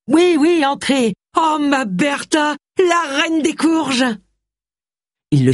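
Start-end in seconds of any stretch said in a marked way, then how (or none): 0.1-4.0 high rapture, drawn out slowly at the end; gushing adoration. A narrator's reading. Harry rejoicing over his prize gourd, gushing. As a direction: swoon high and slow over your beloved.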